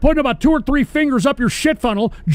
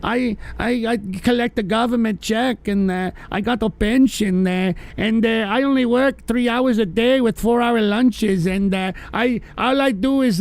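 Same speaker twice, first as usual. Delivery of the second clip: mocking lazy European socialist. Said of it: "All those socialist assholes are like".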